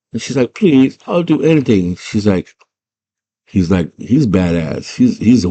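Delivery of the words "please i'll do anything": high-pitched mock begging; pitched-up imploring whine; pleading. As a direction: imitate a desperate girl pleading. Girl begs for her brother back.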